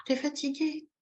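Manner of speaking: put-on gentle parent-to-baby voice; acted sympathetic tiredness. Shown as a demonstration. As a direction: say it as if sharing the weariness. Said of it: "Il faut que ce soit en accord".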